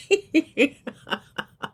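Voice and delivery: amused high-pitched laugh; real laugh. Real, not acted. Recapper amused at both getting on her nerves, laughing. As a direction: laugh at your own telling.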